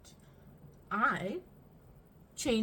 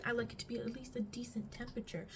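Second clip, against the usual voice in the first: quick soft whisper; 6 dB quieter, faster than usual. Real, not acted. Whispered aside.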